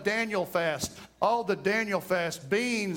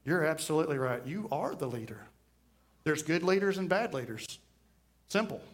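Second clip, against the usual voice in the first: lower, softer, unbothered answer; calm reply; 5 semitones lower, 4 dB quieter than usual. Retold or imitated. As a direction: settle him down calmly. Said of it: "And I just calmly sit there and say".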